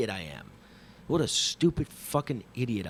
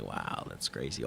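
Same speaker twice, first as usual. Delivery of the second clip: awed hush; 8 dB quieter than usual. Retold or imitated. Looking down at the Amazon.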